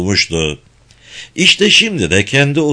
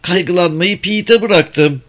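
Mrs. Phelps; 8 semitones higher than usual. A narrator's reading.

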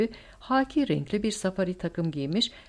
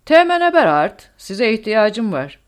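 loud, high commanding shout, drawn out at the end; roaring summons; 5 semitones higher, 12 dB louder than usual. A narrator's reading. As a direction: bellow for a subordinate officer through the door. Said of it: "açtı ve kükredi".

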